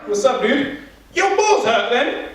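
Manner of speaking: high, cartoonish British greeting; imagined British doctor. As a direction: greet like a caricature British gent. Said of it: "then a British dude walks up".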